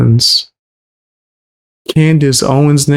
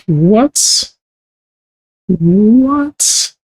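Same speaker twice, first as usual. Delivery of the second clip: high, drawn-out rising disbelief; incredulous what; 8 semitones higher than usual. Acted. Host reacting to a guest's tough talk, incredulous. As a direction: stretch it upward in mock bafflement.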